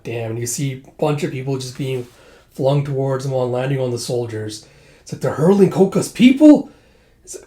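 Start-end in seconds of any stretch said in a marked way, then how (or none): 5.2-6.7 high loud shocked cry. Retold or imitated. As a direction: cry out in disbelief. Shocked at battle.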